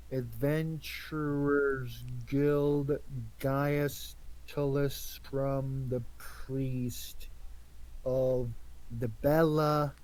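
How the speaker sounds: slow halting syllable-by-syllable reading; staccato. Pretend. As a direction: sound out each word haltingly. Sound it out syllable by syllable.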